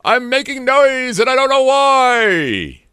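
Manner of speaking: high, loud, slow yelling celebrity voice; Robin Leach impression. Shown as a demonstration. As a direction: bellow it high and slow like a TV host. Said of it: "that's my Robin Leach impression".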